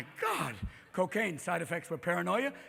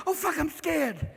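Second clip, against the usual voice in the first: mock fear; 7 semitones higher, 3 dB louder, slower than usual. Deer mocks being scared.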